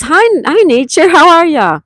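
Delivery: high, loud, gushing hello; excited greeting. Real, not acted. Welcoming a viewer by name, gushing loud hello.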